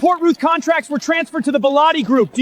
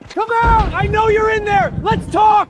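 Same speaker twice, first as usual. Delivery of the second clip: shouting; 9 semitones higher than usual. Acted. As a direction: yell for her to come out. Shouting outside Ivy's door.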